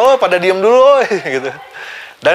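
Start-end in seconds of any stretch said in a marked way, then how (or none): 0.0-1.1 high, loud crowd-hushing call; retold shout. Translating the man's yell to the crowd, mock shouting. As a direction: holler it out much higher, imitating a shout. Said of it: "dia teriak".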